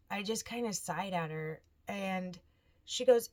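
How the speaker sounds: usual speaking voice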